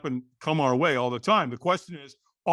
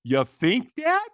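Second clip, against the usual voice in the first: put-on cheeky kid; 9 semitones higher, 5 dB louder, slower than usual. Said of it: "Davey's like".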